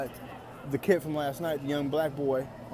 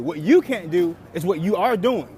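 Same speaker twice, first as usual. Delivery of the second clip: louder, slow and deliberate; slow firm rebuke; 5 dB louder than usual. Real, not acted. Filmer lecturing the guard, slow and firm. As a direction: lay down the rule.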